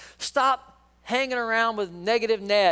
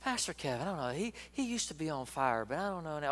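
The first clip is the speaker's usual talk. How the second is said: much softer, hushed gossip voice; gossiping murmur; 12 dB quieter than usual. Retold or imitated. A church gossip plants doubt about the pastor, in a hushed murmur. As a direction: murmur it like doubt whispered behind someone's back. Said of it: "they talk to you like".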